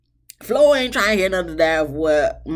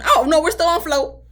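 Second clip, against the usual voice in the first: high, louder oh no, caught herself; sudden self-correction; 10 semitones higher, 5 dB louder than usual. Real, not acted. Vlogger correcting herself to viewers, surprised and high. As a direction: realize you jumped ahead and pull back. Catches herself changing topic.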